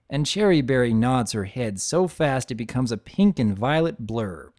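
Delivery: usual speaking voice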